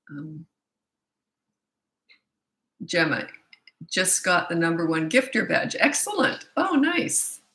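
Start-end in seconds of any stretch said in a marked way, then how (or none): 5.8-7.4 high-pitched pleased exclamation; delighted. Real, not acted. Host congratulating Gemma on her badge, delighted. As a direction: cheer them, pitched way up.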